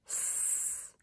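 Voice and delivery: seed stretched slowly into S, E, ed; stretched word. Shown as a demonstration. Teacher stretches seed for the class, slow and drawn out. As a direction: pull seed apart, sound by sound. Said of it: "stretch and shrink".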